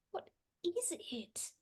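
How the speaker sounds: hushed nervous whisper. Acted. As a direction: barely breathe the question. Nervous in eerie corridor.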